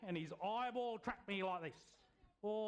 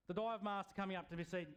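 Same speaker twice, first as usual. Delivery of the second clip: usual speaking voice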